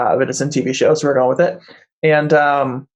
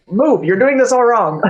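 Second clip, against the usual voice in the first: higher-pitched, exasperated order; bossy scolding; 8 semitones higher than usual. Acted. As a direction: barge in and take over, pitch jumping up. Bossy Emily barges in to help.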